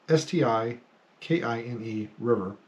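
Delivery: usual speaking voice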